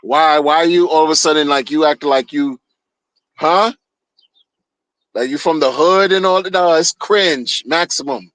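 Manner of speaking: ordinary talk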